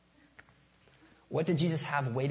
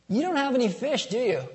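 high, louder, playfully mock-innocent ask; teasing question; 10 semitones higher, 6 dB louder than usual. Jesus teasing fishermen who caught nothing, playful. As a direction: tease them like you already know the answer.

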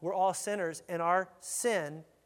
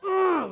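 harsh groan; 12 semitones higher, 11 dB louder than usual. Retold or imitated. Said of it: "harshness to it".

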